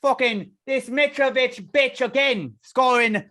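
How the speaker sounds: high, loud hammed-up British grumble; mock British accent. Complaining about Mitrovic in a mock British accent. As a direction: grouse like a gutted English fan.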